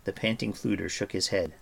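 ordinary delivery

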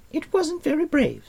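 put-on very high girl's voice; Eilonwy, matter-of-fact. A narrator's reading. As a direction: pitch way up as a frank girl.

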